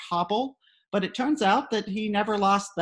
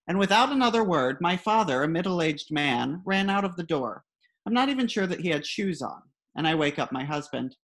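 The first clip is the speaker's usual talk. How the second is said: ordinary delivery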